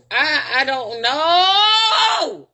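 long drawn-out, loud "know"; distressed wail. Real, not acted. At a loss what to do, distressed wail. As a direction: stretch the last word into a helpless wail.

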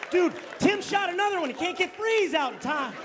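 high comic partner cop. Acted. Other cop commenting.

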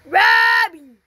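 sudden loud high yell; excited shout. Real, not acted. Shouting at game rabbit.

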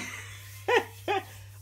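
airy high giggle while crying; teary high giggle; high breathy laugh through tears. Real, not acted.

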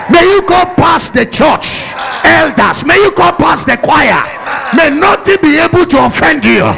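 loud, fired-up sermon delivery; thundering church preacher; full-force preaching. Acted. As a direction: preach it out at the top of your voice.

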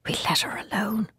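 hushed, breathy whisper. A narrator's reading. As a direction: whisper it so she won't hear. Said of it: "he whispered".

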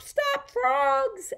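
high-pitched drawn-out stop call; playful holler. Pretend. Host halts the hopping little frogs, playful holler. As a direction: holler it high to halt the game. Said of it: "until I holler".

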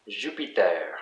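"Jupiter" as "zhu-pee-TAIR"; French pronunciation. Shown as a demonstration. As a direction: say "Jupiter" the French way, last syllable stressed. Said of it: "it's always the last syllable in French".

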